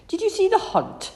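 high, bright-eyed mother's question; Mum's voice. A narrator's reading. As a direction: lift the voice up for Mum.

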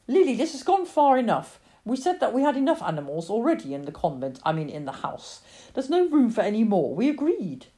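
firm, emphatic mother's scolding; exasperated mother. Acted. Mother refuses Lily another animal, firm exasperation. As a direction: lay down the household rule.

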